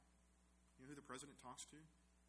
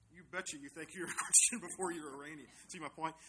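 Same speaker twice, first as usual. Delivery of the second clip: laughing emphasis; 3 semitones higher, 11 dB louder than usual. Real, not acted.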